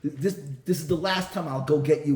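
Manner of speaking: loud, furious scolding of a son; angry father. Acted. Father yelling at son over crushed crayons, furious shout. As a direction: blast the kid like an outraged father.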